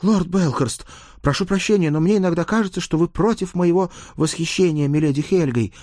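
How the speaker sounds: high, strained, formal complaint; tense page. A narrator's reading. Page challenges lord over admiring milady, tensely. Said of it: "напряжённо сопя носом, заявил он".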